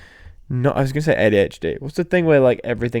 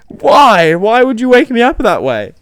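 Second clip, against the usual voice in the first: louder, higher, mock-outraged why; indignant outcry; 9 semitones higher, 6 dB louder, slower than usual. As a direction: protest like you've just been jolted awake. Retold protest at waking.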